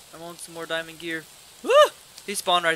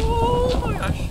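high loud slow frightened cry; drawn-out cry of fright; 11 dB louder, much slower than usual. Real, not acted. Scared right after gasp.

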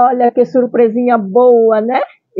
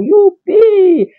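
excited; 9 semitones higher, 4 dB louder than usual. Real, not acted.

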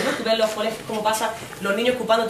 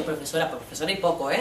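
ordinary delivery

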